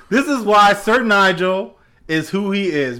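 ordinary talk

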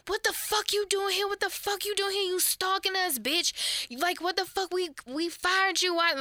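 high, heated yelling at her; going off. Retold or imitated. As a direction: snap at the intruder, pitch way up. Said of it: "just, like, going off".

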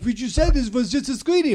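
his French date complaining, in a mock French accent. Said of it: "or whatever French accent it is".

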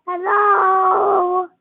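usual speaking voice

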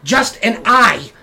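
spooked, cursing New York rat; wiseguy rat. Acted. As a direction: rat refusing danger, swearing up a storm. Rat reports what it saw.

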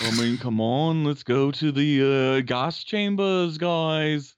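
slow, hammed-up [name] accent; bad [name] accent. Shown as a demonstration. Co-host retries the mocked [name] impression, slow bad British accent. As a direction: drag it out like a badly faked Beatle. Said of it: "like, a bad [name] accent".

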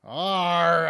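loud, growly pirate Arr. Pretend. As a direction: ham up a pirate snarl. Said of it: "you gotta say it like a pirate".

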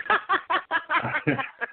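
high burst of real laughter; big laugh. Real, not acted. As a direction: let a big laugh break out. Laughing at his own joke.

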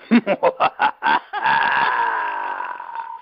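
low villain laugh; deep, menacing evil laugh; evil chuckle. Real, not acted. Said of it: "There's the villain laugh".

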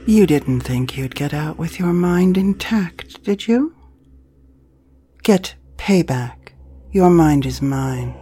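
loud, menacing promo voice; horror trailer voice. Acted. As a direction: taunt the listener like a horror villain.

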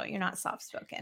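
soft unvoiced teasing aside; whispered aside. Real, not acted. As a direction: whisper it as a playful jab at your brother. Teasing aside to loud brother.

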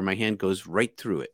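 ordinary talk

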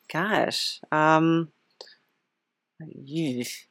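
ordinary delivery

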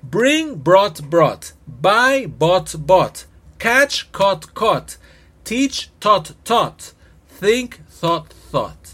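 each verb form said slowly and separately, the first word loud and high; teacher's drill voice; clear drill pronunciation. Shown as a demonstration. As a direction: spell out every past form for the class. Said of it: "Ya empiezo con el grupo".

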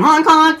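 high honking clown nose sound; clown horn honk. Pretend. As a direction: squeeze out a silly honk honk. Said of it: "That was my clown nose going off".

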